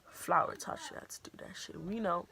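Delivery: hushed, unvoiced we know; whispered knowing parents. Parents telling daughter they knew, whispered. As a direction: murmur it like tired parents who knew all along.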